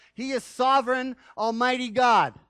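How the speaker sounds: preacher booming God's might; proclaiming loudly. Real, not acted. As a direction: ring out God's majesty.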